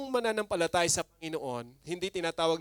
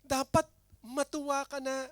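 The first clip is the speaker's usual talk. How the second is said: normal talking voice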